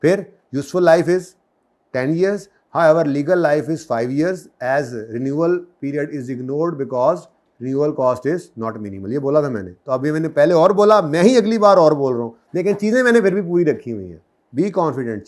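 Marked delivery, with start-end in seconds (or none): 0.6-9.1 slow, measured phrasing of a model answer; model exam answer. Shown as a demonstration. Teacher showing students how to phrase the answer, slowly. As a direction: spell it out the way students should write it. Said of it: "ऐसे बोलोगे".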